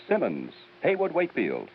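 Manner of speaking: usual speaking voice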